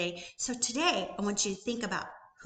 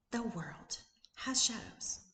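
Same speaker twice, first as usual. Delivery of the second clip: slow confiding whisper; 3 semitones higher, 4 dB quieter, slower than usual. Real, not acted. Coach telling viewers everyone has shadows, slow whisper. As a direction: lean in and whisper it slowly.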